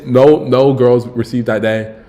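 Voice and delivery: ordinary delivery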